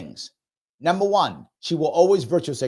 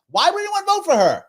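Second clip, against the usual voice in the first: much higher, mock bafflement; a viewer's baffled question in a high voice; incredulous high question; 13 semitones higher, 4 dB louder than usual. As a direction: squeak it out in disbelief. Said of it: "'Cause you're asking yourself".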